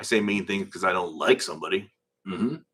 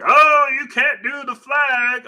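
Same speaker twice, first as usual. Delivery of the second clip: high, loud, drawn-out mocking wail; mock outrage; 8 dB louder, much slower than usual. Mimicking his complaining family, mock outrage.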